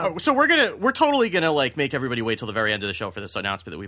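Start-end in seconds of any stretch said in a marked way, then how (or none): 0.0-1.5 high, loud indignant whine; mock outrage. Real, not acted. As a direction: act scandalized.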